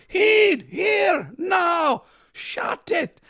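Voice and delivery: high Scottish shout that fades; Scottish yelling dad. Retold or imitated. Dad calling one of the kids, high Scottish shout. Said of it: "he'd just be like".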